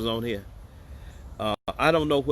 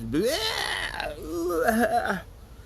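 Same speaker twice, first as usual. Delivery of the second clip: shrieking laugh. Real, not acted. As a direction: burst into a high howl of laughter. Laughing at his own joke.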